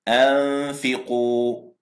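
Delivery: أنفقوا very slow, nun hidden in a long nasal hum before the فاء; drawn-out أنفقوا, nun held in the nose; nasal ikhfa demo. Shown as a demonstration. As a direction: model أنفقوا for the class with a long ghunna. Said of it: "رددوا معي".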